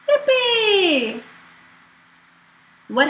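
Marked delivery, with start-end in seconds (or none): 0.1-1.2 cheer. Acted. Celebrating escape.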